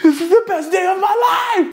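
retold giddy, laughing outburst; gleeful squeal. Storyteller relives his gleeful reaction.

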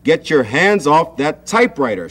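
high, loud shout; father barks to stop the typing; father shouting at Sam. A narrator's reading. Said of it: "shouted his father".

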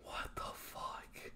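slow, unvoiced what the fuck; shocked whisper. Real, not acted. Reeling from the death, a shocked whisper. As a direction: barely breathe it out in disbelief.

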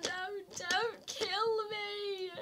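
normal talking voice

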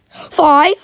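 loud, high startled question; surprised. Real, not acted. Seeing a new time, loud surprised question.

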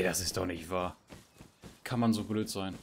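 normal talking voice

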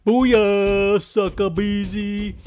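high, drawn-out victory cry; stretched-out loud celebration; triumphant gloat. Pretend. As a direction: crow over a beaten foe, slowly. Gloats after winning.

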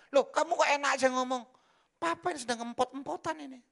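loud, high indignant father; mock exasperated retort. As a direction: snap back at the daughter.

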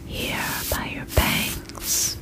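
ordinary talk, nothing marked

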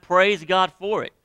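high, loud and slow praise; booming joyful praise; exclaimed praise. Real, not acted. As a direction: shout it like a preacher's hallelujah. Preacher exclaiming.

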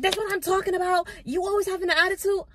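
high, loud, fast shrill complaint; mock yelling boss. Boss yelling at the stylist about her attitude, high and loud. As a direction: shriek it like the boss losing it.

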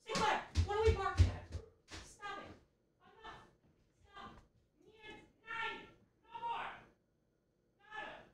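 quiet high pet talk; hushed high dog scolding. Real, not acted. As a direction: hush the dog in a high little voice.

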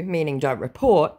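ordinary delivery

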